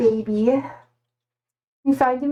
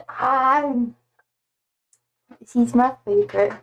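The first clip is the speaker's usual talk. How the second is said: ordinary talk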